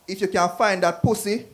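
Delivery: loud challenge. Acted. Jamaican guy in the joke daring the devil, loud challenge. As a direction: throw the dare out loudly.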